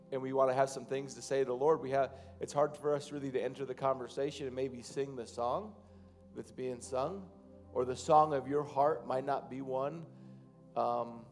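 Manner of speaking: ordinary talk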